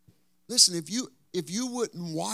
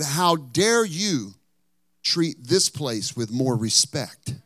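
slow, loud retold scolding; stern reproach at full voice, slow and emphatic; indignant rebuke; 5 dB louder, much slower than usual. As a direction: imitate a preacher rebuking, each word drawn out. Acted rebuke of the pastor.

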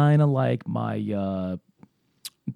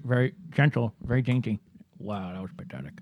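pipe in mouth; 3 dB quieter than usual. Real, not acted. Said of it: "have a pipe in my mouth".

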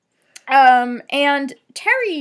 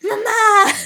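loud, high laughing Mama; laughing mock cry; 11 semitones higher, 10 dB louder than usual. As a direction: laugh through a hammed-up plea. Repeating the play line laughing.

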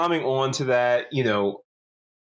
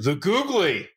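higher announcing «The googly»; big reveal; 6 semitones higher, slower than usual. Acted. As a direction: unveil it like a prize. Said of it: "ready, Liam?".

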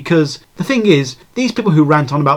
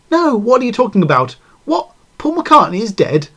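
higher-pitched, disbelieving retold reply; mock-baffled, pitched up in disbelief; incredulous; 8 semitones higher than usual. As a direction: act out a friend's astonishment on the phone. Acted phone quote, disbelieving friend.

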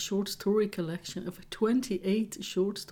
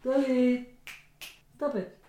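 raised-pitch plea to a pet; calling off the cat; 5 semitones higher than usual. Real, not acted. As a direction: coax the cat to quit, higher voice.

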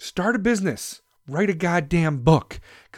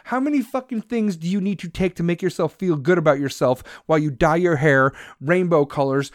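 ordinary delivery

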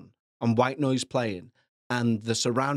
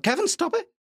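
much higher voice telling Kevin off; high squeaky mock scold. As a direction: squeal "Kevin, stop it" as a listener. Voicing listener scolding him.

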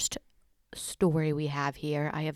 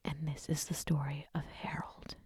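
spooky whisper; 8 dB quieter than usual. Pretend. Spooky intro to a scary story.

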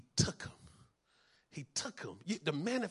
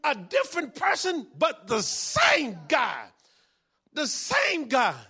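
slow, loud, higher shouted repeat; preaching shout; 9 semitones higher, 9 dB louder, slower than usual. Real, not acted. Preacher to congregation, slow shouted emphasis. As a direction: hammer the line home to the congregation.

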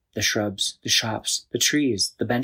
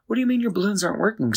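alarmed; 11 semitones higher, much faster than usual. A narrator's reading. Chris alarmed by the problem.

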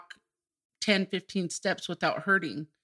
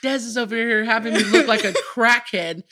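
laughing; 3 semitones higher, 7 dB louder than usual. Retold or imitated. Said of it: "I was like".

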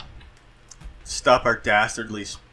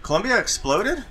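surprised; 9 semitones higher than usual. Real, not acted. Surprised disbelief at news.

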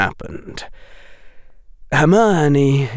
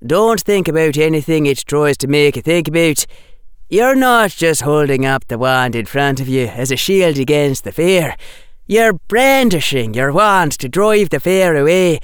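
raised, firm student captain voice; emphatic schoolboy instruction, pitched up; Anthony; 6 semitones higher, 4 dB louder than usual. Acted. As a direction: coach them insistently, building in force.

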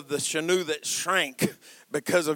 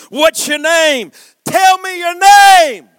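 high, very loud slow shout, building; escalating demand; 10 semitones higher, 14 dB louder, slower than usual. Acted. God wrestling Jacob for his name, demanding roar. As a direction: bellow the order, harder the second time.

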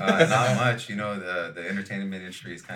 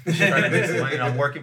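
loud; 9 semitones higher, 10 dB louder, faster than usual. Acted. Joking plea, suddenly loud.

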